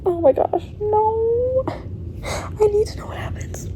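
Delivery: high whining cry into a whisper; half-joking anguished whimper; mock despair. Real, not acted. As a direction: wail it like it's unbearable. Distressed by long wait.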